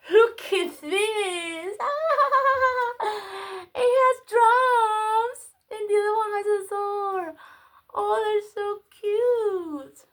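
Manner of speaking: high, drawn-out gleeful squeal with laughter; giddy, laughing, high-pitched gushing; squealing delight. Real, not acted. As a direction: squeal and stretch the words in joy. Squealing over the new find.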